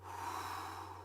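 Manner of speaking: soft unvoiced sigh of breath; demo sigh out. Shown as a demonstration. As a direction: let the air go in a sigh. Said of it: "And breathe out".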